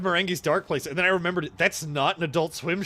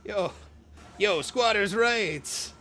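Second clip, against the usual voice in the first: slow, stretched-out quote voice; slow drawl; 6 semitones higher, much slower than usual. Acted. Quoting the cartoon to a friend, slow and drawn out. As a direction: drag each word out lazily. Quoting Xavier Renegade Angel.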